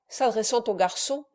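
ordinary delivery

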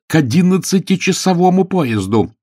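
high, loud urgent order; shouted order. A narrator's reading. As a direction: call out to the driver in a hurry. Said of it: "крикнула она солидному шофёру".

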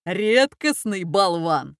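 slow, drawn-out jeer; mocking jeer. A narrator's reading. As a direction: stretch out the insult mockingly. Laughing brothers insult the boy. (издевательски, нараспев)

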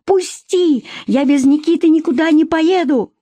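high, piercing cry to let go; a wife screaming at her husband who grabs her; desperate shriek. A narrator's reading. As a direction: scream to break free.

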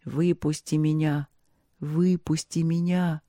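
a muffled voice pleading from below. A narrator's reading. Muffled voice from under the oak begging the youth to free it. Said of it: "говорил глухим голосом".

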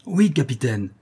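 eager cry of assent; a crew answering its captain with spirit; cri plein d'élan. A narrator's reading. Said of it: "s'écria l'équipage avec un élan". (cri d'équipage plein d'élan)

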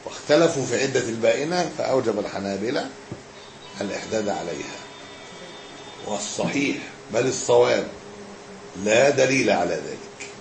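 measured scholarly reading with long pauses; formal text reading. Acted. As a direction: read it out phrase by phrase, letting endings drop. Reads the book aloud.

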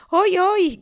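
high, loud mock-frightened cry; loud fake-scared goose call; shouting. A narrator's reading. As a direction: yelp like a startled goose.

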